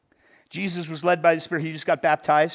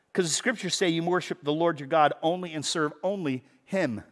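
ordinary delivery

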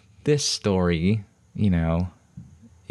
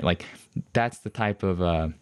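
ordinary delivery

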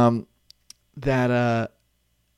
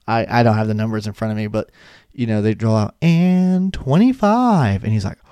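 ordinary talk, nothing marked

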